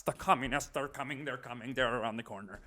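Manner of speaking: hushed mock fearmongering, voice sinking low; fearmongering sales pitch. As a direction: drop to an ominous hush as if danger approaches. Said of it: "That's how they sell it".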